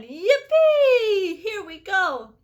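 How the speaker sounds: very high, loud whoop easing into the cheer; whoop, then ride the cheer down; joyful shout. A narrator's reading. Grandpa Joe riding the rising elevator, joyful whoop. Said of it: "shouted Grandpa Joe again".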